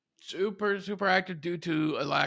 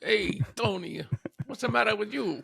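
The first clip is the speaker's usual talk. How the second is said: put-on pitched-up New York tough-guy accent; playful New York Italian accent; 6 semitones higher than usual. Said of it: "fake New York accent".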